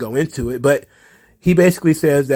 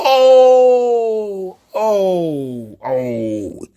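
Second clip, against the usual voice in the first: an ouch reaction to a burn; pained oh; 4 semitones higher, 4 dB louder, much slower than usual. Real, not acted. Reacting to a roast.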